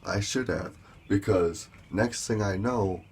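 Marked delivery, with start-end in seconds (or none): none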